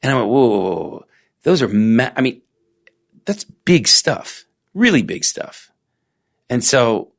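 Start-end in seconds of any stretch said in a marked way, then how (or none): none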